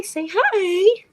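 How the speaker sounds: high coaxing pet talk. Acted. Owner coaxes her cat to greet the viewer, high pet voice. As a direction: coax the cat up high, sing-song.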